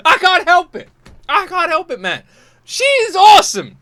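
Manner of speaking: overwhelmed. Real, not acted. Raving about the singer, high loud excited. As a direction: let it all burst out.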